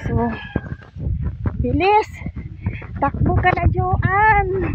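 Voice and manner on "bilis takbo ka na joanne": higher, hurried call to hurry up; excited urging. Real, not acted. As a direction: urge her on to run. Urging companion to run.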